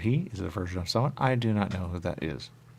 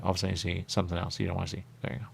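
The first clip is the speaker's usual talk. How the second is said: ordinary talk, nothing marked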